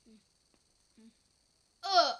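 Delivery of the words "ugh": loud ugh of disgust; disgusted groan. Real, not acted. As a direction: groan out loud at the sour taste. Reacting to sour candy.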